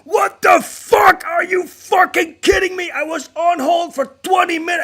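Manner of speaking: high, loud outraged yelling; furious screaming caller. Retold or imitated. Caller put on hold screams at the agent, high and loud. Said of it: "And he's screaming at me".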